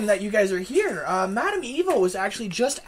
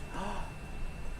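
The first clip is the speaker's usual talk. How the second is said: gasp; 12 dB quieter than usual. Acted. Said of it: "She turns and she goes".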